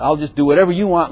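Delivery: normal talking voice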